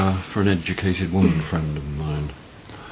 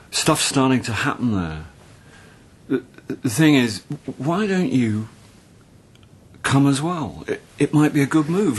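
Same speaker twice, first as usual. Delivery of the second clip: higher-pitched, halting and tentative; tentative, stumbling offer in a raised voice; hesitant invitation; 5 semitones higher than usual. Acted. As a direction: work up awkwardly to asking, with pauses. Awkward invitation to come to Australia.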